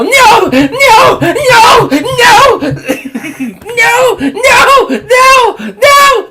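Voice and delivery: high, loud, panicked no's ending in a shriek; hammed-up horror-movie victim screaming for her life. Retelling the slasher opening, a victim shrieking at Ghostface. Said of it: "this woman go".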